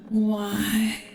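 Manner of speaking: ordinary delivery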